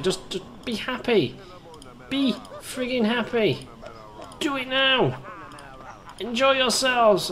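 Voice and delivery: high, drawn-out bossy orders; mock exasperated command. Player ordering game characters to enjoy themselves, mock exasperated. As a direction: order them about in a strained high voice.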